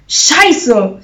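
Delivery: loud, frustrated swearing; exclaimed curse. A narrator's reading. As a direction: call out the curse. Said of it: "rief sie".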